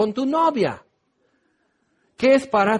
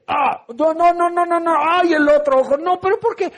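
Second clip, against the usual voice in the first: flinch from the mud in the other eye; mock panic; 8 semitones higher than usual. The blind man squirming as mud hits his eyes, high panic.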